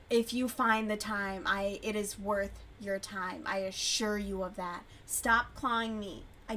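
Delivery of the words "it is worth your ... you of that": ordinary talk, nothing marked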